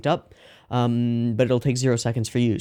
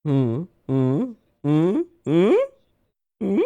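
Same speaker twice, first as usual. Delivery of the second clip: excited humming; 7 semitones higher, much slower than usual. Real, not acted. Excited reaction to scene.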